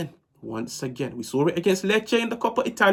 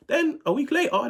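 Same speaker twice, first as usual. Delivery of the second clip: ordinary talk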